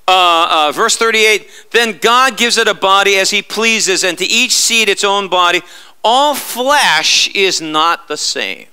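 ordinary talk

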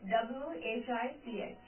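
ordinary talk

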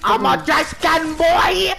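high, loud, slow; silly proud yell. Real, not acted. Player bragging about his disguise, silly yell. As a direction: announce it gleefully.

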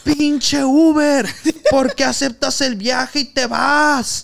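loud, slow furious yell; angry phone yell. Retold or imitated. Real customer yelling at the Uber driver over the phone.

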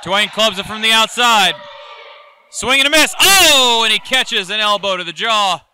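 excited ringside play-by-play; wrestling commentator. Acted. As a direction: call the near fall live. Live play-by-play of the match.

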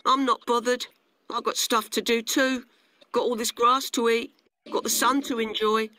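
high grasshopper character voice; carefree grasshopper, huffy. Acted. Grasshopper brushes ant off.

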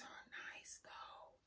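slow, soft whispered aside; hushed little compliment. Real, not acted. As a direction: breathe it like a secret.

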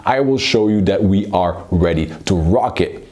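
ordinary delivery

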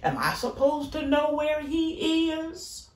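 high, mocking retort; sarcastic. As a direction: throw the question back with scorn. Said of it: "sarcastically says to the Lord".

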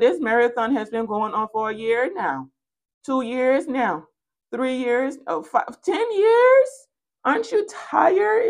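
climbing to a high incredulous squeal; mounting disbelief. Acted. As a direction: build from puzzled to astonished, pitch rising. Acted disbelief at growing years.

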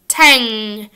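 "Tang." with a long back nasal NG. Shown as a demonstration. Teacher drilling tang after tan, nasal NG. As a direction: ring "Tang." out through the nose. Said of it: "Your tongue goes up in the back".

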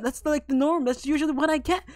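ordinary delivery

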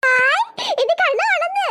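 high, stretched-out cheer; delighted squeal. Acted. Sister thrilled the baby woke, delighted high cooing. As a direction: burst out with slow, high delight.

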